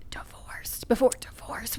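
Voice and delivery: hushed, whispered aside; confidential whisper. Real, not acted. Granddaughter confiding about grandmother's early divorce, whispering. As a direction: drop to a whisper as if it were taboo.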